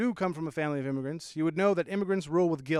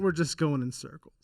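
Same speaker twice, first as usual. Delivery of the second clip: ordinary talk, nothing marked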